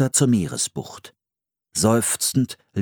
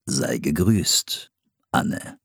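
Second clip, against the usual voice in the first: a hint of a lower, very slow Squanto; Squanto's reply; 5 semitones lower, much slower than usual. Squanto answers Anne, lower and very slowly. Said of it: "erwiderte Squanto".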